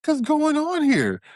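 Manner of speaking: high, loud disbelieving cry; shocked onlookers. Retold or imitated. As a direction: blurt it out in shock. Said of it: "they looking like".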